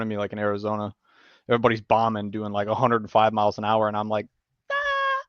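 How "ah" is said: very high mock panic squeak; a tiny terrified squeak; panicked ah. Rider as cars pull out, a high panicky squeak.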